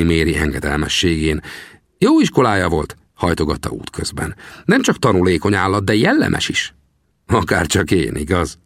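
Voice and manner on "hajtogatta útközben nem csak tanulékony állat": ordinary delivery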